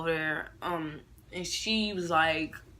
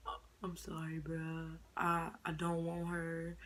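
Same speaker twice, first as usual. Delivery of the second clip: mumbled apology; 9 dB quieter than usual. Retold or imitated. Boyfriend crying on phone.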